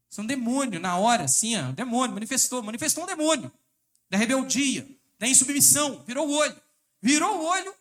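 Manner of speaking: loud, rising sermon outburst; fiery preaching. Acted. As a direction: thunder it from the pulpit. Preaching at full force.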